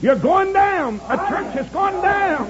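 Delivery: high, loud revival preacher; high-pitched pulpit cry; full-force preaching. Acted.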